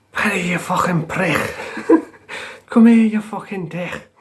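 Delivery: imitating a raging cellmate, high-pitched; hammed-up furious shout through a laugh; mock angry yell. Cellmate bangs on the door, calling Paddy out, mock furious yell.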